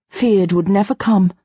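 ordinary delivery, nothing marked

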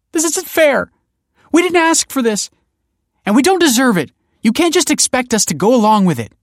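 loud, very high, distraught complaint; distraught mother crying out; upset protest. A narrator's reading. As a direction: wail in indignant protest. Distraught mother protests bad news.

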